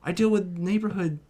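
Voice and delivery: put-on a nervous teenager begging off; whiny protest. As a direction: whine like an overwhelmed kid. Peter voiced whiny and pleading.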